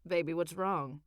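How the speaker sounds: husband asking his wife what is wrong, worried; lightly voiced worried husband. A narrator's reading. A hint of a low worried husband.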